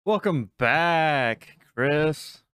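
slow, drawn-out hype greeting; announcer. Acted. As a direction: stretch out the welcome, loud and high.

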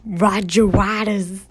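slow, booming radio host sign-off. Pretend. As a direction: stretch out the main man like a late-night DJ. Late-night radio outro of song.

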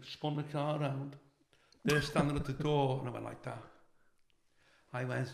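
ordinary delivery

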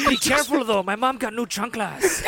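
hammed-up Mexican accent, slowing down; mock Mexican voice. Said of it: "my Mexican voice on".

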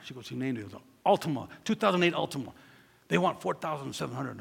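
ordinary delivery

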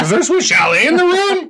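high, laughing eager dog; playful high-pitched pet voice; excited dog's voice. Acted. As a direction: voice a dog thrilled about food. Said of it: "They're like".

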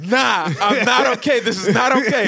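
high, loud protest through laughter; laughing protest. Real, not acted. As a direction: object while cracking up. Cracking up at friend's joke name.

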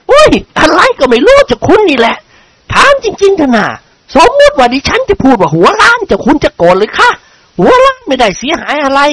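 high-pitched, protesting lady's voice; chatty noblewoman, pitched high; teasing lady character. Acted. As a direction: play a talkative lady needling a lord. Lady teases the lord.

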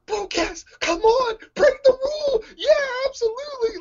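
very high, near-squealing cheer; excited cheering. Retold or imitated. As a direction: egg him on like a fan. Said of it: "I was like".

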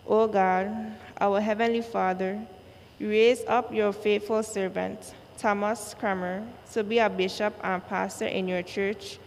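usual speaking voice

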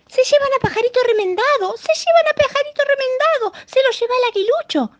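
high, loud alarmed cries; birds shouting. A narrator's reading. Birds in the tree shouting the eaglet steals him. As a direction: shout it up from the branches. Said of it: "gritaban los pájaros desde las ramas".

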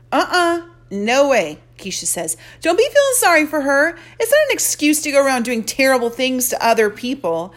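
loud, fast, high sassy scolding; sassy friend. Acted. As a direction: talk your friend out of pity, fast. Keisha scolding her friend.

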